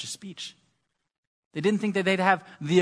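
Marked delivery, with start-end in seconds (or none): 0.0-0.6 whispered. Real, not acted. Trailing aside.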